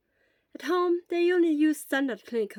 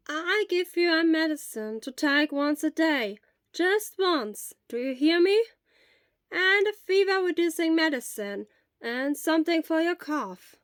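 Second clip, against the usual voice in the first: lightly voiced elderly nurse; 7 semitones higher, 6 dB louder than usual. Elderly school nurse gently questions a feverish boy.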